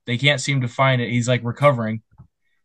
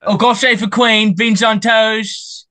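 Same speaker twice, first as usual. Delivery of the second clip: high, loud hammed-up British accent, beans on toast drawn out slowly; playful mock British accent, much higher; 8 semitones higher, 5 dB louder, slower than usual. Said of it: "the British have all the best sayings".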